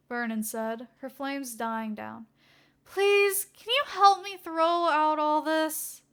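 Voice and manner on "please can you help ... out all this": high, slow pleading voice; young woman pleading for help; pleading assistant. Acted. Vernon pleading.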